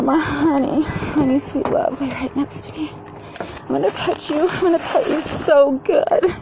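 put-on high sing-song baby talk, some words whispered; cooing to the dogs. Petting her min pins, high cooing pet talk. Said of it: "I'm like".

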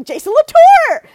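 high, loud, drawn-out call of the name; excited shout-out of a name. Retold or imitated. Friend recalling Preeti spotting Jason Latour, excited high call. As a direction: imitate a friend spotting a celebrity, calling out his name. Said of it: "in the same tone of voice every time".

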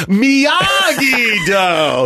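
very slow, drawn-out "Miyagi Do" shout; mock movie shout. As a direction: stretch every syllable like the movie kid. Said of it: "when he goes".

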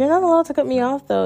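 high, quick, playful objection; joking protest. Retold or imitated. Speaker retells joking with a guy she was texting, playful protest. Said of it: "like, joking".

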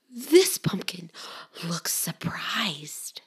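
slow breathy whisper of wonder; hushed surprise. Acted. Reading to children, slow whisper. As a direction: draw it out in a hush.

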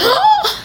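sharp, very high gasp; pained gasp. Real, not acted. Listener hearing the console was run over, shocked gasp. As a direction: suck in air at a painful image. Reacts to car crushing the console.

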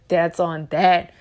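ordinary talk, nothing marked

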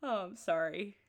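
usual speaking voice

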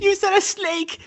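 mock outrage. Real, not acted. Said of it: "friend calls out teller".

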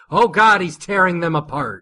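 sudden loud, high-pitched alarm; shocked outburst. Acted. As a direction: burst out in horrified amazement. Abrupt shocked reaction to the fight.

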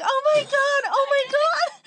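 high, loud mock freak-out; hammed-up drunken excitement; imitated excited friend. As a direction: repeat it loudly, way up in pitch. Retelling friend's excited drunk reaction.

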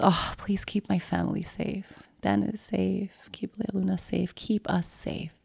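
barely voiced, pleading under her breath; whispered prayer. Praying for her family in a soft whisper.

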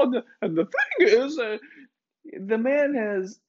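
usual speaking voice

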